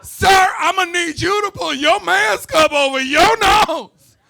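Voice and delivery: very high, loud, slow, squeaky whine; mock a panicky young store clerk policing masks; whiny Gen Z clerk. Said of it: "some little millennial or Gen Z".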